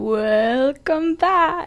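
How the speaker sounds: hammed-up slow radio intro; mock radio-host welcome. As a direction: stretch it out like a DJ teasing his co-host. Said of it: "[name], you're always so good at the".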